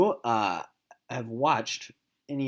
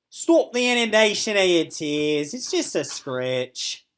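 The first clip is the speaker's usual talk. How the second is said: higher, louder haughty dismissal; harrumphing; 5 semitones higher, 8 dB louder than usual. Acted. Milo the dog dismissing the fuss, haughty and huffy. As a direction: brush it off with lofty disdain. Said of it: "Milo harumphed".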